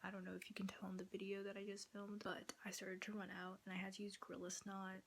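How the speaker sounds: whispering so the parents can sleep; hushed late-night whisper; quiet at night. Real, not acted. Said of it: "I have to talk quiet 'cause obviously my parents are sleeping".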